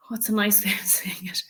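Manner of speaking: a quick hushed aside while hunting for the word; whispered aside. Real, not acted. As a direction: whisper it to yourself. Whispered aside searching for words.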